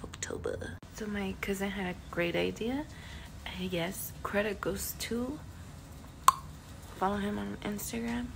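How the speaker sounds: hushed unvoiced aside; whisper. Real, not acted. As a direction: whisper so not to wake anyone. Whispered vlog intro.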